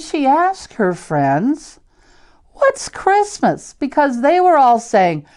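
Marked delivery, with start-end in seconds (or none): 2.5-3.7 high, slow, wide-eyed little hippo asking; lightly voiced innocent hippo question; curious little hippo. A narrator's reading. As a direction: ask it like a puzzled baby hippo. Hippo asks friends in story.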